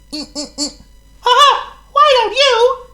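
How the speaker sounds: very high, loud laughing mouse; a tiny mouse giggling at his idea; squeaky laughing mouse; Squeak the mouse. Acted. Voices Squeak.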